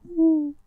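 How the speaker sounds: high, loud crowd-style ooh; high fan "ooh". Acted. Imitating the fans in the corner, high loud coo. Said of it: "in the corner went".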